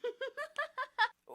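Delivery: a high, loud character giggle. Acted. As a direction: giggle at him mockingly. Kokichi laughs at Kaito.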